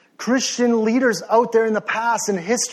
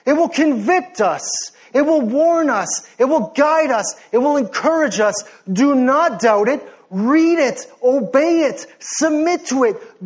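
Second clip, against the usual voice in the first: impassioned, raised-pitch exhortation; passionate preaching; 5 semitones higher than usual. Real, not acted. Preacher urging the congregation to read God's word, passionately. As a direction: preach it hard, driving each short phrase home. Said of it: "I just get passionate sometimes".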